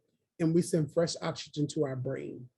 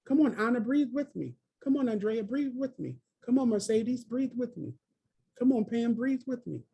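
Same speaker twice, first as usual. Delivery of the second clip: higher, coaxing call to each name; encouraging; 6 semitones higher than usual. Real, not acted. Coaching each participant to breathe, high and coaxing. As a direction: cheer each person on by name. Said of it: "I encourage you on the call to breathe with me".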